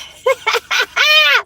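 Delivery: loud, high real laugh breaking out; cracking up at his texts; high burst of laughter. Real, not acted. Laughing out loud at his messages, high and loud.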